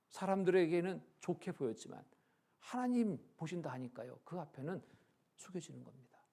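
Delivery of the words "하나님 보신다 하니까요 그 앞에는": ordinary talk, nothing marked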